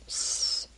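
long soft voiceless hiss; imitated static hiss; sardonic radio hiss. A narrator's reading. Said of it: "the radio said sardonically".

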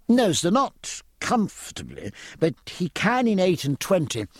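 lightly acted eager explanation; lightly voiced midshipman. A narrator's reading. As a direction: explain it keenly to a visitor, a hint of a midshipman.

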